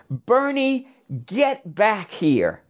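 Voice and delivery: high, drawn-out calling after him; imitating a drunk mother's yell; mock yell. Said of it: "she yells".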